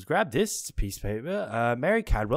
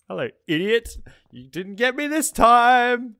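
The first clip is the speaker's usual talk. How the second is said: gloating taunt; 10 semitones higher, 8 dB louder than usual. Real, not acted. Player taunting the killer after escaping, loudly gloating. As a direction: call out mockingly, stretching the end.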